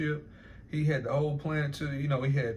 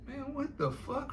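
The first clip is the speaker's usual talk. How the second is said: high, drawn-out, softer incredulous reaction; retold disbelief; 11 semitones higher, 6 dB quieter, slower than usual. Recalling hearing what he said, high-pitched disbelief. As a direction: stretch it out high in mock disbelief.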